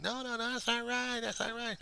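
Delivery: put-on screamer, far above own pitch; mock protesting crowd. People objecting to his picture of heaven, high mock yelling. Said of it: "people will be screaming and yelling".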